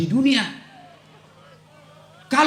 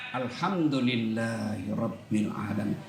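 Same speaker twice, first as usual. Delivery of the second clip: slow, much softer and lower; slow grateful praise; 9 dB quieter than usual. Shown as a demonstration. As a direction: say it with a full open heart. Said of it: "ucapkan dengan lapang dada".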